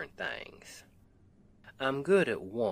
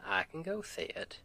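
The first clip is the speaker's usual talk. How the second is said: ordinary delivery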